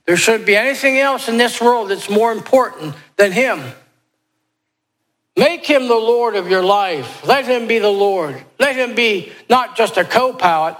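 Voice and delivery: preacher pressing the altar call; fervent exhortation. Acted. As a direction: urge the congregation to surrender.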